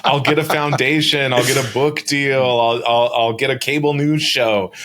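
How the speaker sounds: listing her lost riches with a laughing, mocking lilt; mocking Loomer's claim. Retelling Loomer's deposition claims as a light, laughing mock. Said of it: "she basically says, like".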